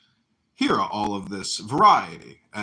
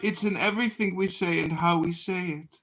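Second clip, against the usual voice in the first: high, sing-song stage delivery; overly dramatic and flowery; theatrical voice; 8 semitones higher than usual. Acted. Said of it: "It's a theatrical voice".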